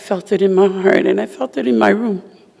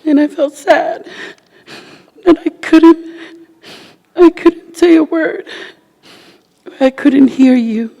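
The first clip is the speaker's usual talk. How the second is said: higher, strained voice near tears; tearful farewell letter; 5 semitones higher than usual. Real, not acted. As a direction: read the letter to him fighting tears. Reads farewell letter to husband.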